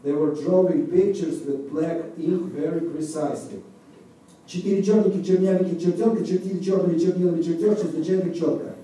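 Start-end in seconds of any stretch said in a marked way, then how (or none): 4.5-8.8 rapid-fire tongue twister. Shown as a demonstration. Demonstrating a Russian tongue twister to the audience, rapid-fire. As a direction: rattle it off fast and precisely. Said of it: "Next on Twister".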